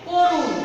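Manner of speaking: করুণ said with a rounded o in the first syllable, like korun; teacher shows how করুণ is really said, rounded o; spoken pronunciation. Shown as a demonstration. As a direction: give করুণ its true spoken sound, first vowel rounded. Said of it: "কিন্তু উচ্চারণ হবে".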